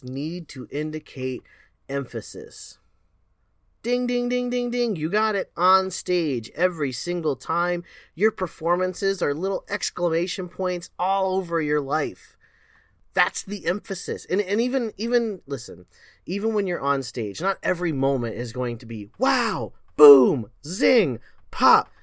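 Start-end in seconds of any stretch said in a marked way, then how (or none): 3.8-5.0 higher sing-song bell dings; game-show ding. Pretend. Cheering the audience's right answer with bell dings. 19.2-21.9 each word popped out higher and louder; punchy exclamations. Shown as a demonstration. Showing performers their big moments with popping exclamations.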